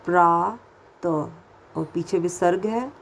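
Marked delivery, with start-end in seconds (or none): 0.1-1.1 प्रात drawn out very slowly; slow teaching read; slow syllable demo. Shown as a demonstration. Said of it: "देखिए".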